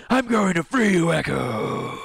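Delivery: heroic vow. Acted. Hero promising to free his friend, heroic vow. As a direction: vow it like a swordsman, trailing the name. Voices Zephyr vowing to free her.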